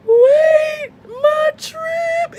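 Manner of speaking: high, loud, breaking cry. Retold or imitated. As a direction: wail it as the tears start. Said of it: "started breaking down in the street".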